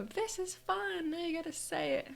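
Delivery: very high, sing-song excitement; gleeful echo. Retold or imitated. Daughter repeating mom's catchphrase back to her, gleeful echo. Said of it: "And I go".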